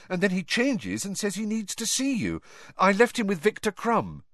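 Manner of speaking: put-on young Harry. A narrator's reading. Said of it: "Harry explains hurrying".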